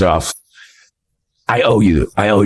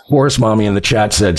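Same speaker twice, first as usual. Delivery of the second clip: ordinary talk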